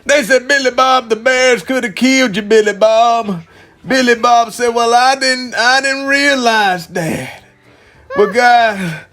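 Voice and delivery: usual speaking voice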